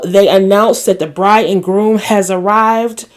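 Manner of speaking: usual speaking voice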